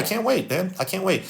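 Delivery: normal talking voice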